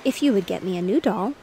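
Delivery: ordinary delivery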